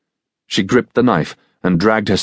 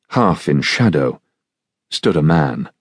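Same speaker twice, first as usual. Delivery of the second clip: usual speaking voice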